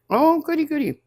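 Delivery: high gleeful goody; delighted. Real, not acted. Delighted at being thanked.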